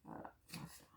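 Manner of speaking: whispered aside to herself, barely audible; under-breath mutter. Real, not acted. Crafter whispering to herself mid-task, barely audible. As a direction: murmur it to yourself as you work.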